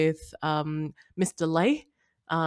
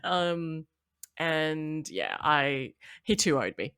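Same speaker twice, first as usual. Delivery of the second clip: normal talking voice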